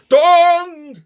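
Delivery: long high loud bong; clock chime. A narrator's reading. Clock striking midnight, a long loud bong. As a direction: ring it out like a big clock bell.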